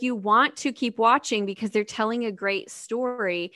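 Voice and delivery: ordinary talk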